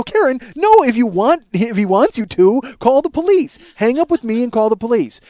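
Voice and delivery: loud, high, exasperated insistence; urgent ordering. Retold or imitated. Husband telling wife to call the police, urgent. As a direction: bark frantic orders down the phone.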